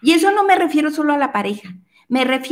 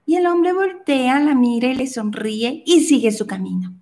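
normal talking voice

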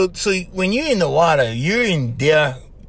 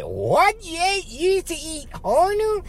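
high-pitched mock disbelief; shocked listeners; 12 semitones higher, 4 dB quieter, slower than usual. Podcast host mimics listeners hearing about eating turtles, mock shock. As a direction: imitate an appalled audience, pitch way up. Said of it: "you guys gonna be like".

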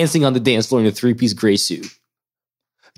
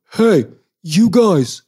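the guard stops them, a high drawn-out call; 9 semitones higher, much slower than usual. Retold or imitated.

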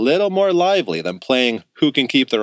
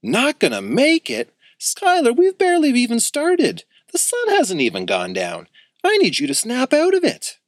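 frantic; 13 semitones higher than usual. A narrator's reading. Axel urging sleepy Skylar to snap out of it, frantic. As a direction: freak out and shake your friend awake. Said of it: "Axel nearly flipped".

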